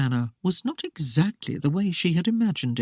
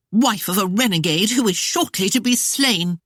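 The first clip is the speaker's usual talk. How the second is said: higher voice, snapping back with scorn; 4 semitones higher, 3 dB louder than usual. A narrator's reading. Claudia snapping back at Christopher about his wife, higher scornful voice. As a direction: snap it back contemptuously. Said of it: "Claudia snapped back".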